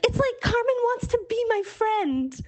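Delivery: put-on high, giddy voice like a thrilled kid; giddy delight. Telling her women's group about Carmen, giddy high voice. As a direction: say it as if you can't believe your luck.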